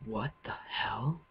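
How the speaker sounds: slow, whispered disbelief; drawn-out whispered question; hushed whisper. Real, not acted. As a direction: whisper it slowly, letting it rise at the end. Uneasy reaction to game noise.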